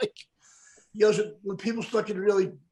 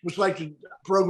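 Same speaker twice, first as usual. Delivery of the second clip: ordinary talk, nothing marked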